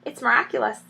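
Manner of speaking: ordinary delivery, nothing marked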